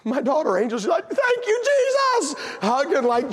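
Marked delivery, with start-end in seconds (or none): 1.1-2.4 very high, jubilant cry; mock cheerful gratitude. Sister Angel spared her punishment, mock cheerful crying out. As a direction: squeal it out in hammed-up joyful relief.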